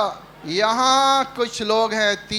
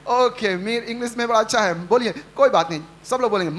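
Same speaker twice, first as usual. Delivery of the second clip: ordinary talk, nothing marked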